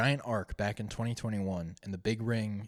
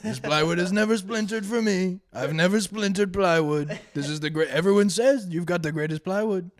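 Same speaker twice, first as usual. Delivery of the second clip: boastful Trump voice, higher and louder; Trump impression; 6 semitones higher, 5 dB louder than usual. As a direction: brag like Trump selling plywood. Trump impression of plywood salesman.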